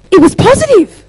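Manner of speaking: very high, loud put-on voice; hammed-up high-pitched exclamation; squeaky imitated answer. Acts out depressed woman's perky answer.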